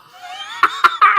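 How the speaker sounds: high squealing laugh; disbelieving laugh. Real, not acted. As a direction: burst of high shocked laughter. Shocked at the £200 donation.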